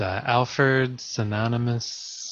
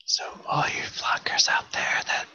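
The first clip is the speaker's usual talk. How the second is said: ASMR whisper; 4 dB quieter than usual. Pretend. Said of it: "when we talk like this".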